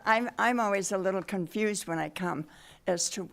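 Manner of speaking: ordinary talk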